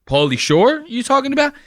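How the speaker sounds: high, loud feigned confusion; mock confused. Mocking Joel pretending not to know the name, high and loud. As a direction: play dumb, overselling the question. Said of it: "bullshit and go".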